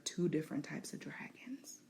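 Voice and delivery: whispered aside; hushed point to the audience. Real, not acted. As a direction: drop to a whisper for emphasis. Stressing a point to viewers.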